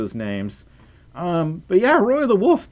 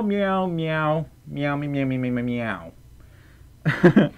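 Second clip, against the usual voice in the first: playful cat meowing; 3 dB quieter, slower than usual. Pretend. Streamer saying hello to a viewer's cat Chloe, playful meowing. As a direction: meow playfully as if chatting to a cat.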